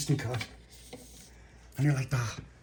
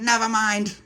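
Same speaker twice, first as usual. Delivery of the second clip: dismissive; 11 semitones higher, 6 dB louder, slower than usual. Acted. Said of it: "And he went".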